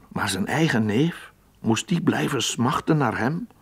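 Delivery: ordinary delivery, nothing marked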